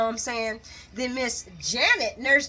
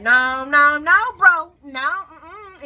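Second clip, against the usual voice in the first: loud, rising drawn-out no's; mock emphatic refusal; 4 semitones higher, 10 dB louder, much slower than usual. [name] refusing to go with doctor, loud mock refusal. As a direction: build louder and higher in refusal.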